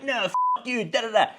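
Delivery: very high-pitched imitation of an irate woman; exaggerated high mock ranting; mock angry tirade. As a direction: mimic someone going off, trailing into nonsense syllables. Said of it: "Just going off at him".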